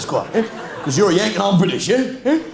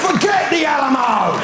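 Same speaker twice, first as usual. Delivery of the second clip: mock battle cry; 7 semitones higher, slower than usual.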